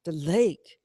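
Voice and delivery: ordinary delivery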